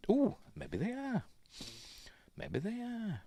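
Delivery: high, soft, surprised musing; surprised ooh. Real, not acted. Checking the cigarette brand online, surprised. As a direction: perk up at the find.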